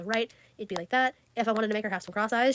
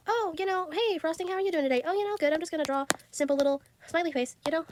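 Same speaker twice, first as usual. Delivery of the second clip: higher, chirpy little character voice; cutesy pretend chat; 6 semitones higher than usual. Greeting her character Frosting in a high playful voice. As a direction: play both sides of a sweet chat.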